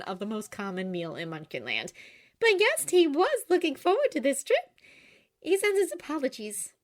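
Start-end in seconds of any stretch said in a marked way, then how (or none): 2.4-6.7 high, light Glinda. A narrator's reading. Glinda explaining to Oscar, high and bright.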